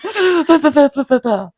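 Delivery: high stammered gibberish, dropping away; mock panicked babble. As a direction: imitate someone too frantic for words. Imitating dad's panicked babble.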